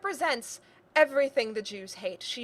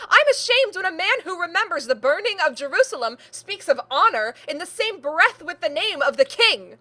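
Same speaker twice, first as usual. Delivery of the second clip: loud, raised-pitch indignation; impassioned outburst; 4 semitones higher, 6 dB louder than usual. Acted. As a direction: let the shame pour out as a loud tirade. Acted quarrel, niece's angry outburst.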